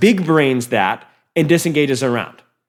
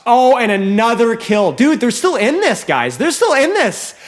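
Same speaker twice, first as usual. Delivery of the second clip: excited casting; 6 semitones higher than usual. Real, not acted. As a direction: hype it up as the fight turns. Caster hyped by comeback.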